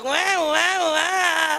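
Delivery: drawn-out high mock bawling; sulking child's wail. Child throws tantrum at mum's refusal, mock crying. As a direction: wail like a child denied treats. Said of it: "anak itu akan ngambek".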